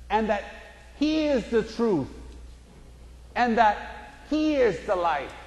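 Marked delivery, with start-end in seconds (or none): none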